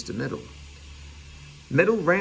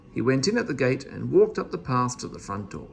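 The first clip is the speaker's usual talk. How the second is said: ordinary delivery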